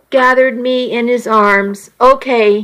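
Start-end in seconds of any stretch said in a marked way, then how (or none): none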